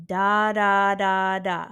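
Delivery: "da, da, da" joined in one steady breath; connected D articulation. Shown as a demonstration. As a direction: flow through each "da" without stopping. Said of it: "It's da".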